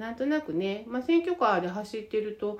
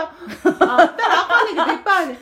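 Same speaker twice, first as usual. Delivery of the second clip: loud laughing words, pitched higher; 9 semitones higher, 12 dB louder, faster than usual. Real, not acted. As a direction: laugh it out, much louder and higher. Laughing about too much material.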